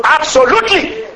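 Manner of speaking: ordinary talk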